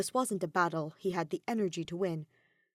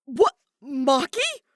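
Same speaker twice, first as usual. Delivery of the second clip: loud, higher stunned cry; shocked outburst; 7 semitones higher, 11 dB louder, much slower than usual. Acted. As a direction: gasp out the name in disbelief. Kaito shocked to see Maki.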